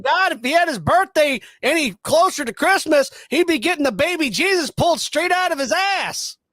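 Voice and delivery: high, loud ranting Cornette voice; fiery wrestling promo rant; Jim Cornette. Retold or imitated. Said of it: "my best wrestling impression is Jim Cornette".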